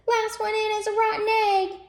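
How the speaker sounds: high, loud chick voice; tiny chick squeaking a dare; cheeping chick. Acted. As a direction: chirp it out high. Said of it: "cheeped a chick".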